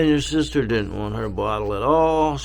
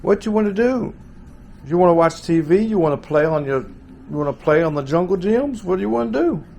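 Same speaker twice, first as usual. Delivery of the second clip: put-on higher, sing-song coaxing to a baby; baby talk; 6 semitones higher than usual. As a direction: coo encouragement at the little one.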